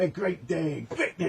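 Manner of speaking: high, singsong mock greeting; imitating his sing-song greeting. Mocking the man who greets me on the street, singsong. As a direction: copy how he chirps it. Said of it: "This is how he does it".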